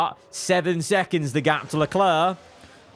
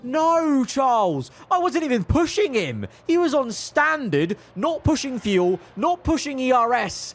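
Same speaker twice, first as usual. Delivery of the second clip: higher, rattled complaint; exasperated disbelief; 10 semitones higher than usual. Real, not acted. Team boss complaining to viewers about his crashed driver, rattled. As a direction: protest that it wasn't his fault.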